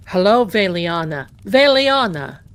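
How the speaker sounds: acted-out loud greeting; hearty phone greeting. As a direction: imitate his loud, booming hello on the phone. Acts out his phone greeting.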